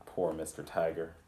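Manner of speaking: usual speaking voice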